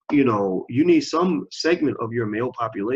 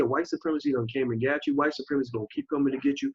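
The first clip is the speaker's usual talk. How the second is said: usual speaking voice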